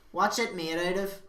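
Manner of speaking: a nosy punter; mock customer.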